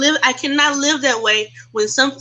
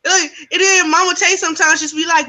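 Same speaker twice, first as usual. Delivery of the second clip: ordinary talk, nothing marked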